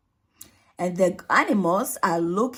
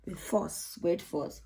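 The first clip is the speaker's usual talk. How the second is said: ordinary delivery, nothing marked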